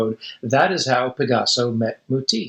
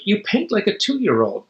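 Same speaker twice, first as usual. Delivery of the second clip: quipping; 6 semitones higher than usual. Acted. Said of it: "Matisse quipped".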